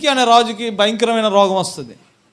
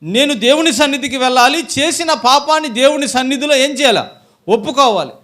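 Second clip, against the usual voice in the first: loud, higher, urgent sermon voice; forceful preaching; 6 semitones higher, 8 dB louder than usual. Real, not acted. Preacher voicing the sinful woman's resolve to confess, forceful preaching. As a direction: preach it out with raised voice.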